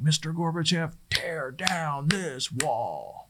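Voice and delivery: slow, stretched-out famous speech impression; Ronald Reagan. Speaker quoting Reagan's speech on the wall, imitated oratory. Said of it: "thinking about Reagan say something like".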